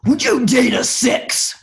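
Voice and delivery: loud, slow motivational-speaker bellow; Tony Robbins. Acted. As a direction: boom it out high and drawn-out, challenging the crowd. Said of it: "channel my inner Tony".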